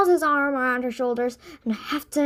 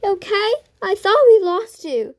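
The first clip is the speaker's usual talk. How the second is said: sobbing; 8 semitones higher, 8 dB louder than usual. Acted.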